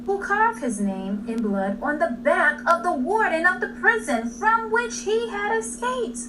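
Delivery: plain reading voice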